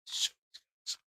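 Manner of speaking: breathy whispered aside; whispered as he cues up the clip. Acted. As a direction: whisper it to yourself.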